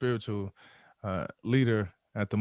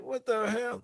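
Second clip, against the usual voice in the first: pained disbelief; 11 semitones higher, slower than usual. Retold or imitated. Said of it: "I'm just like".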